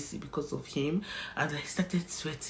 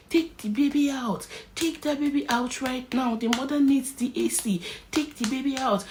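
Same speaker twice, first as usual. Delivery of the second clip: harsh order; 9 semitones higher than usual. Retold or imitated. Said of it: "in a harsh tone".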